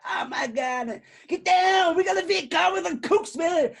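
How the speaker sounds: high, loud frantic battle yell; panicked soldier. Retold or imitated. Friend imitating Vietnam War soldiers, high frantic shout. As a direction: yell it like a soldier under fire.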